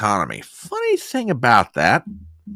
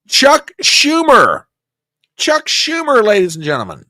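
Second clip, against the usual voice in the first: announcer build-up; 9 semitones higher, 6 dB louder than usual. Real, not acted. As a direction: call him out like a ring announcer. Announcing next clip.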